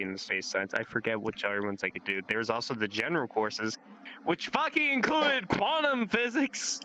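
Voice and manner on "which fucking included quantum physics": high, slow, outraged squeak; exasperated. Real, not acted. Complaining to friend about school, high slow exasperation. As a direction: stretch out the disbelief at the coursework. Griping about required quantum physics.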